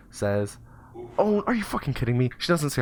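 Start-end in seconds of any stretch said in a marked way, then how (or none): 1.2-2.3 high-pitched incredulous outburst; mock outrage. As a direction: play it appalled and exasperated. Mock secretary outburst, then retracted.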